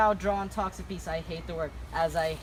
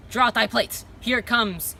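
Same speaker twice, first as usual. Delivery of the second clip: loud challenge; calling out; 4 semitones higher, 5 dB louder than usual. Acted. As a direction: call it out boldly. Servant calls out a challenge.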